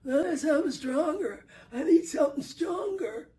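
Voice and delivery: high, loud, fast pleading voice; a strained, whining addict; desperate man. Retold or imitated. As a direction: beg for something stronger. Man in corner demanding drugs.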